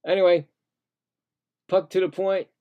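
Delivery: ordinary talk, nothing marked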